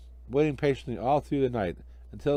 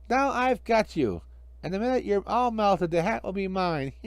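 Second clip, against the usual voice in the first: gloating villain; 7 semitones higher than usual. Acted. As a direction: savour the triumph.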